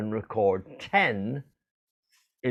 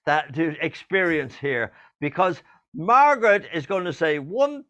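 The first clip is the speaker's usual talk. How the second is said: high, excited magician patter; showman build-up; 4 semitones higher than usual. Acted. Host hyping Margaret's miraculous candle trick, showman style. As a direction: hype it up like a stage magician.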